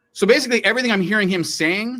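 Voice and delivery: ordinary delivery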